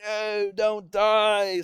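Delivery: long drawn-out mock wail, high and loud; mock anguished scream. Imitating game characters screaming in anguish behind a sweet song, mock anguished scream. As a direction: imitate screaming in anguish, stretching each word. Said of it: "screaming in anguish in the background".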